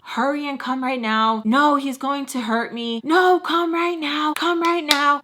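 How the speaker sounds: mother voiced begging for help; frightened plea. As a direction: beg urgently, pulling the words out slowly. Mother pleading through door.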